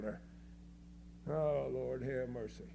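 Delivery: low, sinking groan of dismay; weary exasperation. Real, not acted. Preacher despairing at boastful exploits, weary exasperation. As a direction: let it sag low, wearily.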